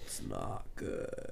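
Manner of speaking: hoarse, breathy croak from a cold; barely voiced frog croak; croaky sick voice. Real, not acted. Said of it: "I sound like a frog".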